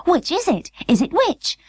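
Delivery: high, desperate doll voice; distressed doll cry. Acted. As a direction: cry it out in a tiny panic. Birdie confused and panicked.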